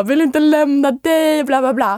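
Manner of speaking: high, slow, loud mock-pining voice; mock romantic plea. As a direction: ham up a lovesick goodbye. Retold romantic tearful farewell. (överdrivet romantiskt och långsamt)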